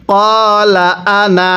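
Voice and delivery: কলা আনা recited slowly, আনা kept short with no stretch; slow recitation. Shown as a demonstration. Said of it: "এই আনায়ের মধ্যে আমরা টান দিব না".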